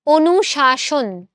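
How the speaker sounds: অনুশাসন slow and loud, both as "sh"; identical sibilants. Shown as a demonstration. Teacher demonstrating for students, অনুশাসন slowly with two equal "sh"s. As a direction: stretch অনুশাসন out, "onu-shaa-shon". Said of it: "দুইটা স এর উচ্চারণ আমাদের কানে same".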